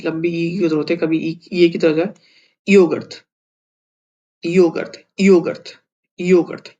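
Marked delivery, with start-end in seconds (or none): 2.7-6.8 योगर्थ said louder and clear, each take stressed; careful pronunciation. Shown as a demonstration. Teacher drills the hard word योगर्थ for students, loud and clear. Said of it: "ये बहुत मुश्किल pronunciation है".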